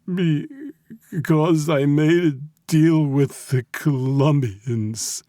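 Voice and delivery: high, slow, tearful stammer; frightened confession. A narrator's reading. Captive admits deal to mob boss, terrified. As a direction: confess haltingly, pitched high and loud.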